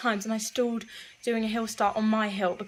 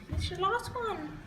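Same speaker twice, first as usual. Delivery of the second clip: pet talk; 9 semitones higher, 3 dB quieter than usual. Real, not acted. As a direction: coax the dog to find his treat. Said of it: "Why do you always talk like that to, like, pets?".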